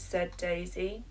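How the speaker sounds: ordinary delivery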